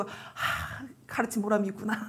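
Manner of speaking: soft breath out, then hushed words; moved, quiet sigh. Retold or imitated. Teacher hearing the child's declaration, quiet satisfied sigh. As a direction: murmur it to yourself after a sigh.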